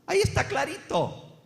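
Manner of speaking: high, excited voice of a convinced reader; imagined reader's voice. Retold or imitated. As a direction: play the sure-of-himself Bible reader. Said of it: "usted dice". (voz aguda de lector convencido)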